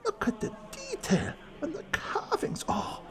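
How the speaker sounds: high, soft, half-whispered awe to himself; marvelling under his breath at the carvings; awed whispered muttering. Acted.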